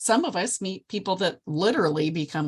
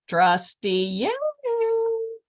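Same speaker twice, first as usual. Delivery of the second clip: very high, slow, drawn-out call; calling out across a hall; 4 dB louder, much slower than usual. Retold or imitated. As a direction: hail her from far across the room. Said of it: "I heard this voice".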